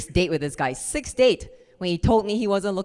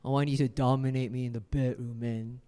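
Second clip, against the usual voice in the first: low, soft man's voice; 5 semitones lower, 7 dB quieter than usual. Retold or imitated. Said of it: "He said".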